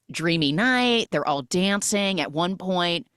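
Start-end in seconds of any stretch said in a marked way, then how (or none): none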